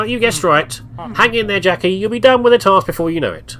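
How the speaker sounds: pep-talk the reluctant intern, bright and pushy; upbeat boss voice. Acted. Voices boss Marv's dialogue.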